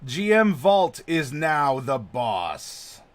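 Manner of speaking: slow, loud proclamation; grand announcement. Acted. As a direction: announce it like a herald. Announcing the new stream boss.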